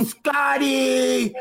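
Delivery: high shouted greeting; excited shout; yelling his name. Retold or imitated. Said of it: "I go".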